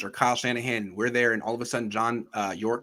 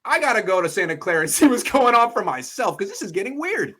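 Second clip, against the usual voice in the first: higher, half-laughing imitation of disbelief; mock bewilderment; 7 semitones higher, 4 dB louder than usual. Owner deciding to check on the team himself, mock bewildered. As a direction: act out a baffled boss, pitched up. Voicing the owner's alarmed reaction.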